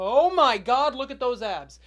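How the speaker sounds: loud, swooping burst of awe; gushing outburst. Acted. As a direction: break off and blurt it out, smitten. Breaks off, gushing at professor's abs.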